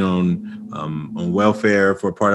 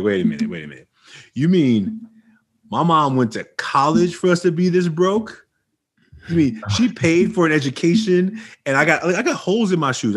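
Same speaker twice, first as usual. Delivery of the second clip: outraged disbelief; 7 semitones higher than usual. Retold or imitated. Teen self outraged at mom.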